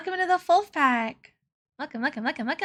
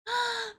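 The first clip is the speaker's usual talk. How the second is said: excited gasp; 8 semitones higher, 5 dB quieter than usual. Real, not acted. Greeting raiders excitedly.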